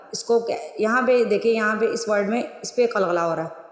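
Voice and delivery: ordinary talk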